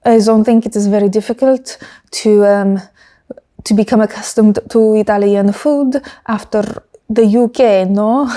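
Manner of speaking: imitated French accent; Fred Vasseur. Team boss joking about British food, imitated French accent. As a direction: put on a French accent for the quote. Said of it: "And Fred was like".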